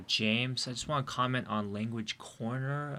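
normal talking voice

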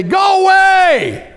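shouted high and slow; hammed-up shout, get lost; mock shout. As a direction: yell it at the door. Shouting at God's knock.

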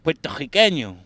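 put-on a weak Puerto Rican accent in Spanish. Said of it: "That one's a hard one for me".

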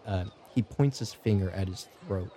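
ordinary talk

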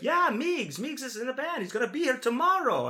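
put-on high-pitched, eager Italian accent; put-on friendly Italian accent. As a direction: imitate an Italian musician chatting up high. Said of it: "he's got this Italian accent".

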